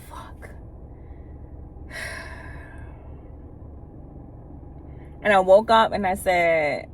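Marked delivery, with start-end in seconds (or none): 0.1-0.5 breathless whisper of dismay; whispered curse. Retold or imitated. 0.5-5.2 long unvoiced sigh of dismay; heavy sigh. After whispered curse.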